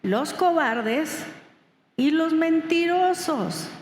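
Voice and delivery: muy despacio, "cobardes" alargado y subiendo; lectura lenta y marcada. Shown as a demonstration. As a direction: leer el versículo palabra por palabra. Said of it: "lo leí despacio". (pausado, recalcando "cobardes" y "mentirosos")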